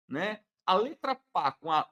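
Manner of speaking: ordinary delivery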